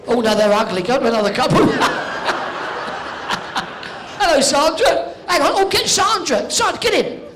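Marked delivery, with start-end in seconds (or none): 1.7-4.1 laughing. Real, not acted. Host laughs at ugly couple.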